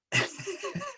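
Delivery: high bright giggle at her own joke; light laugh. Real, not acted. Teacher laughs at her own joke to the group, giggling. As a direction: laugh off the teasing line. Joke about ending the session.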